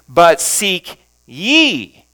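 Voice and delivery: slow, heavy emphasis on "ye"; stress on ye. Shown as a demonstration. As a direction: stretch and punch the word "ye". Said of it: "emphasizing each word".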